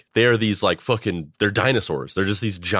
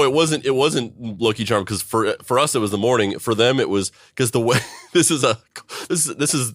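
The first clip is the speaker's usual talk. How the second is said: usual speaking voice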